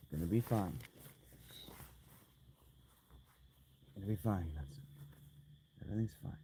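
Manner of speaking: soft, fading reassurance; calm yourself down quietly; self-soothing. Pretend. Calming himself in the cold.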